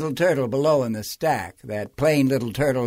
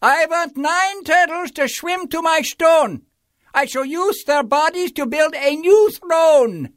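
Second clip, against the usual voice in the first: high, loud royal command; commanding King Yertle; 12 semitones higher, 5 dB louder than usual. A narrator's reading. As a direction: order the turtles about like a tyrant. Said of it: "gave a command".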